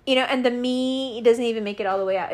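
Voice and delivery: usual speaking voice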